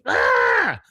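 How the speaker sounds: loud retold yell; mock frustrated scream. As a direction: scream at the ringing phone. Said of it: "He goes".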